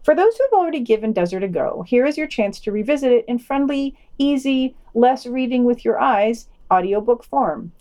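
ordinary talk, nothing marked

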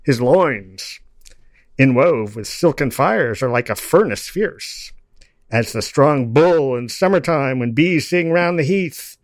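loud, rhythmic declaiming of a poem; steady chanted verse, each line landed; poetry recital. Acted. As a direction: recite it like a bard to a crowd. Recites Blake poem aloud.

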